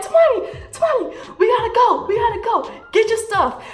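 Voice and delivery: mock panicked sister shouting to flee. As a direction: yell for your sister to grab her stuff and run. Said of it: "She was panicking".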